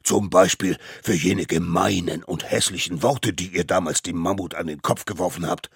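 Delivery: a hint of a solemn judge; lightly voiced stern judge. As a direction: lay out the charge gravely.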